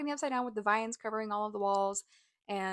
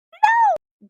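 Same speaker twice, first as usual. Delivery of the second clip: very high, loud shouted no; emphatic mock shout; 17 dB louder than usual. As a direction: shout it out at a sudden high pitch. Loud answer to own question.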